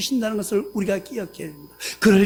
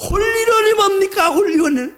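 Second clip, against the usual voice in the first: much higher, louder indignant cry; mock outraged yell; 8 semitones higher, 4 dB louder than usual. Pastor father yells at God about the hole-in-one, outraged. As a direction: shout the botched word in disbelief. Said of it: "그렇게 외치는".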